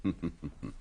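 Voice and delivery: a short, quiet amused chuckle; a fond, low chuckle; soft chuckle. Acted. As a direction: laugh gently at your friend's lovesickness. Poirot amused by friend's confession.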